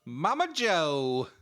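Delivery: slow, drawn-out Southern call. Retold or imitated. Calling to grandmother Mama Joe, slow Southern drawl. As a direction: stretch it out in a hammed-up Southern drawl. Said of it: "get all Southern".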